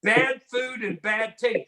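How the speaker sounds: ordinary talk, nothing marked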